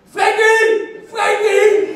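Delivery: high loud shout across the room; calling out. Retold or imitated. Fan calling to Rijkaard holding the cup, high loud shout. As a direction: call out to your hero.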